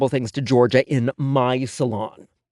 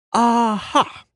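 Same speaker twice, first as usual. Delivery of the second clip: high, bright triumphant aha; like finding the culprit; 11 semitones higher than usual. A narrator's reading. Stylist finding gum in the hair, triumphant high aha. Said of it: "I said when I located".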